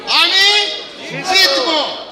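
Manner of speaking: high-pitched, punchy call, word by word; rallying affirmation. Shown as a demonstration. Trainer leads audience in third Bangla affirmation, rallying call. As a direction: call it out for the crowd to echo.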